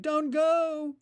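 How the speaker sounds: high, loud pleading cry; an old man's frantic high plea; desperate shout. A narrator's reading. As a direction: shout it as if someone is leaving you. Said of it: "he shouted".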